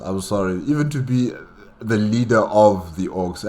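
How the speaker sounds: usual speaking voice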